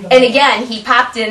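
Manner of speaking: normal talking voice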